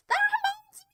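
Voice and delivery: extremely high held falsetto; piercingly high, stretched silly voice; silly squeal. Pretend. Sudden silly high outburst.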